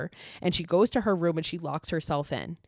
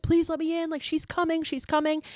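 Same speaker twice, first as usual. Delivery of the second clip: panicked plea; 10 semitones higher than usual. Retold or imitated. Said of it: "screaming, like".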